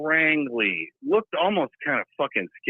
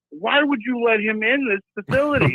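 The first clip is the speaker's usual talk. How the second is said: higher, appalled question; incredulous; 9 semitones higher than usual. Retold or imitated. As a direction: ask it in shocked disbelief. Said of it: "I was like".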